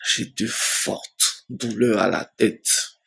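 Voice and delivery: dragged-out, heavy telling; slow, heavy telling of the headaches; slow and weighty. Shown as a demonstration. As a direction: stretch every word of the pain. Repeating his headaches, slowed.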